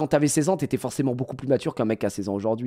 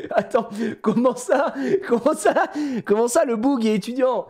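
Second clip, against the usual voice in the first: laughing disbelief; 10 semitones higher than usual. Real, not acted.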